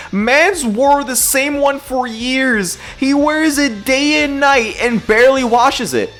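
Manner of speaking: ordinary talk, nothing marked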